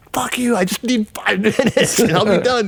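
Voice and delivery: high, stretched-out exasperated outburst; strained comic scream without real volume, laughter breaking in; mock inner scream. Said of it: "in my head, I'm just screaming".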